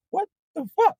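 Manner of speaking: high disbelieving gasp of a question; shocked. Retold or imitated. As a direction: squeak it out, stunned. Driver reacting to hit on.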